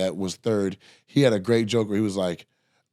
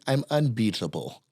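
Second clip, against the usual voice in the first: understated boast; 4 dB quieter than usual. Retold or imitated. Opponent at home poker game declares himself, understated imitated boast.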